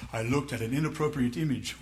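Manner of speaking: low, soft, minimizing admission; mock sheepish confession. Sinner softening his confession to the church, mock sheepish. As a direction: slip it out quietly, acting sheepish.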